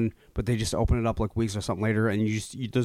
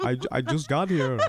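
laughing. Pretend. Vampire roleplay, laughing.

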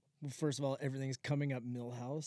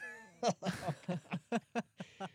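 incredulous laugh; 6 semitones higher than usual. Real, not acted. Laughing at the cheek.

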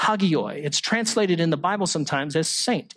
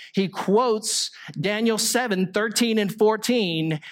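normal talking voice

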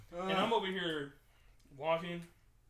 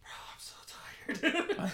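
high breathy whisper, slow and drawn out; exhausted whisper to friends; whispered tiredness; 9 semitones higher, much slower than usual. Retold or imitated. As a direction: sigh it out like you're half asleep. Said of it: "y'all was looking at me like".

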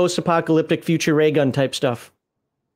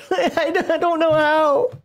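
laughing; 11 semitones higher, slower than usual. Real, not acted. Laughing after wife impression.

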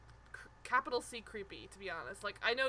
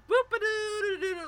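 higher, louder silly boop-a-doo; goofy mocking scat; 10 semitones higher, 8 dB louder than usual. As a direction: scat it like clowning on purpose. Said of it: "pretending to do terribly".

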